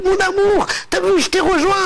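overjoyed wife. Acted. Wife rushing to her late husband in heaven, joyful high cry. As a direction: run to him calling out with delight.

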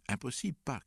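high-pitched alarmed call; crying out. A narrator's reading. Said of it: "cria Oswald". (cri d'alarme, voix aiguë)

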